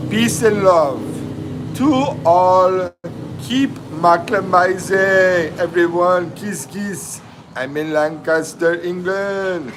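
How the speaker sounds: drawn-out mock Jamaican accent, lifted in pitch. Livestreamer reading out a viewer's sign-off, in a mock Jamaican accent. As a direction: keep up a playful put-on Jamaican accent. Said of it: "I'm gonna do this in a Jamaican accent".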